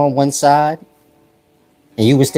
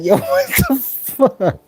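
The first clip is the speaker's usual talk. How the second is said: high, slow startled outburst; mock girl's startled cry; 13 semitones higher, slower than usual. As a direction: yelp it in a falsetto. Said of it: "where you like".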